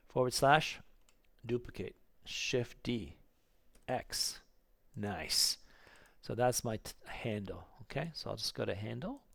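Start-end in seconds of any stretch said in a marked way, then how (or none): none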